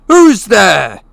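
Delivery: loud, high alarmed shout at the door. Acted. Uncle Vernon shouts at the unseen visitor, alarmed. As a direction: yell it into the dark, startled. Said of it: "he shouted".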